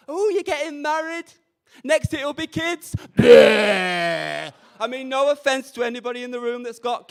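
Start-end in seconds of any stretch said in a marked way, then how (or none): 0.1-2.9 teasing sister. Retold or imitated. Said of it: "She was like". 3.2-4.5 mock retch. Disgust at the idea of kids.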